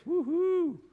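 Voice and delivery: high, loud triumphant woo-hoo; celebratory whoop. Pretend. Pastor celebrating his streak to the crowd, whooping. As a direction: whoop it up like you just won.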